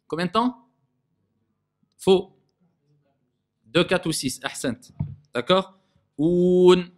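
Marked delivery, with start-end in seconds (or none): 6.2-6.9 loud, held Oun with a pure vowel; prolonged OU. Shown as a demonstration. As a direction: sustain Oun evenly on one note. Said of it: "c'est pas".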